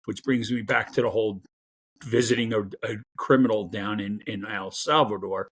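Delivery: ordinary delivery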